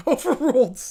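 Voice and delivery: overruled through a laugh; laughing ruling. Acted. Judge laughing as he overrules the objection.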